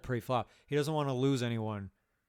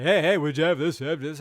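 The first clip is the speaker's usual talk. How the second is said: loud, raised-pitch mock taunt; mock needling; 4 semitones higher, 10 dB louder than usual. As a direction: pester them loudly, as a mocking imitation. Mocking a needling player's taunt.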